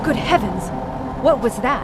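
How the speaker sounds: loud, shocked exclamation; startled outcry. Acted. Visitor jolted by a noise from the tunnels, loud startled cry.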